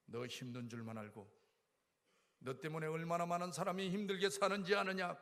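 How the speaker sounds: a hint of a stern God; stern God rebuking. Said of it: "하나님은 그러지 않았습니다".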